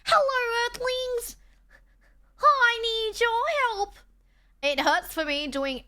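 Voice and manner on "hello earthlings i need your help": put-on very high, slow, loud alien-girl call; extra [name] voice. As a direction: greet the earthlings in a squeaky high voice. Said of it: "that would be extra [name]".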